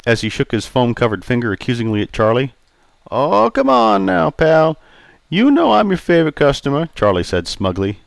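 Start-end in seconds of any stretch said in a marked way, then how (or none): 3.1-6.9 put-on raised, jovial old customer. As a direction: play Charlie, teasing. Charlie smug.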